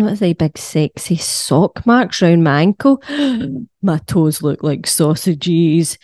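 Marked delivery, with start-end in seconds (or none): 3.1-3.8 a sharp high gasp of horror; mock shocked gasp. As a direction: gasp as if spotting something awful. Shock at swollen ankles.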